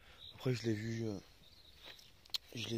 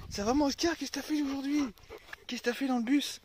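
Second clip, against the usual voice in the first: talking to the dog; 12 semitones higher, 4 dB louder than usual. Real, not acted. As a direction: coo at the dog in a high voice. (voix aiguë pour parler au chien)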